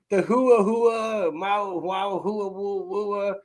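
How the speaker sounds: slow, nonsense mock droning; mock gibberish. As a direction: hum nonsense to mock a boring speaker. Mocking the scientist's droning talk.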